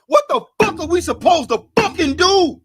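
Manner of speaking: raging at what they're supposed to do; furious outburst. Real, not acted. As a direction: let the outrage explode. Outraged rant at police killing.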